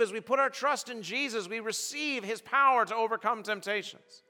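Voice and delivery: high, loud, driving sermon voice; full-force preaching. Acted. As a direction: preach it out to the whole hall.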